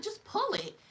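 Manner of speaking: put-on high, pushy girlfriend voice; mock high Hazel voice. Mocking Hazel telling me to pull it. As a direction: pitch it way up, bossy and impatient. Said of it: "She's like".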